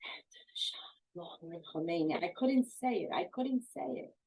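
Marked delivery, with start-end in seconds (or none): 0.0-2.2 barely audible whisper; a frightened girl whispering the forced slogan. Retold or imitated. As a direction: breathe the words out, scared to say them. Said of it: "I remember whispering it".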